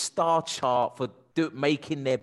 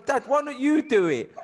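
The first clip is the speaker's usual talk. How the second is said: much higher mock daughter voice; imitating daughter; 10 semitones higher, 4 dB louder than usual. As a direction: play the cheeky daughter, high and light. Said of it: "she's going".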